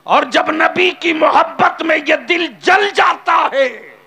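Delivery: slow ringing pulpit shout; climactic shout. Acted. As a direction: cry it out at the peak of the sermon. Preacher's climactic cry.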